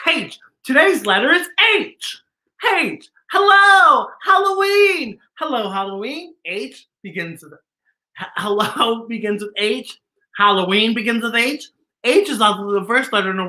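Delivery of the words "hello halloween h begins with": usual speaking voice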